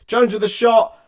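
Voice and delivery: sudden loud, high burst; excited shot call. Real, not acted. Commentator calls Jones's shot live, excited. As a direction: lift the call as he shoots.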